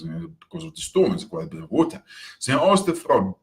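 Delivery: usual speaking voice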